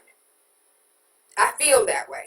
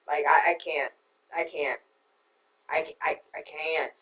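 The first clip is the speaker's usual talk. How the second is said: exasperated trailing off; 5 semitones lower, 6 dB quieter than usual. Real, not acted. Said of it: "that's how I feel".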